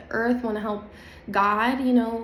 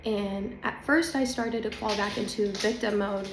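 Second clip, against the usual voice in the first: normal talking voice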